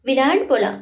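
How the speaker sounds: plain reading voice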